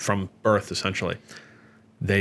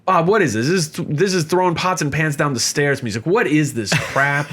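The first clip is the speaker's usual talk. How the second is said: a touch louder, higher mock outrage; uncle scolding; 6 semitones higher, 3 dB louder than usual. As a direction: bark it like a disgusted uncle.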